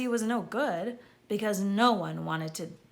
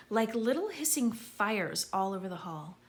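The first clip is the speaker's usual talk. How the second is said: ordinary delivery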